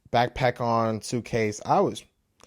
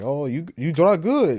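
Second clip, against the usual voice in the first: mock Middle Eastern accent, a bit higher and louder; 5 semitones higher, 6 dB louder than usual. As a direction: imitate the guy's Middle Eastern accent as he praises the drawing. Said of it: "strong, Middle Eastern accent".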